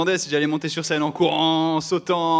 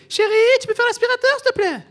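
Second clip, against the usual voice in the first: put-on girlfriend voice; 8 dB louder than usual.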